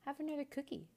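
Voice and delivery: put-on high, hurried boy's offer; high-pitched, eager-to-appease voice; quick offer. A narrator's reading. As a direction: offer it quickly to placate a giant. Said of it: "said the boy quickly".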